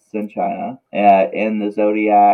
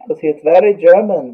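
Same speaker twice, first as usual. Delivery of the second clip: higher, louder mock German accent; hammed-up, badly faked German; horrible German accent; 6 semitones higher, 6 dB louder than usual. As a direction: put on a bad German accent. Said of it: "that was a horrible German accent".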